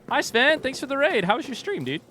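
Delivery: high bright welcome; cheery greeting. Real, not acted. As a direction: greet the raider warmly.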